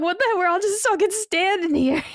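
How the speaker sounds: high, incredulous outburst at a stalled battle; exasperated. Retold or imitated. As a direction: squeak it out in disbelief. Retold frustration at stalled battle.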